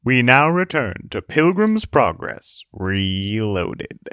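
slow, booming break announcement; radio announcer. Acted. Announcer return.

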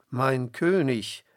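slow, loud call after the king. A narrator's reading. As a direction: call after him across the distance. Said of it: "rief er laut hinter Saul her".

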